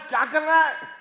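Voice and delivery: loud, high demand; angry yell. Acted. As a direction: yell at the son what he's doing. Father yelling at son.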